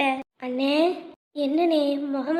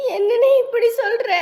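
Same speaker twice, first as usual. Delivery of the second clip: crying disbelief; 4 semitones higher, 5 dB louder than usual. Acted. Mother cries out in disbelief.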